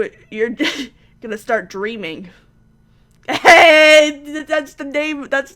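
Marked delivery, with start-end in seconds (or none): 3.3-5.5 loud, high, drawn-out hey; excited shout. Real, not acted. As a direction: shout it out in delight. Excited at hearing the name.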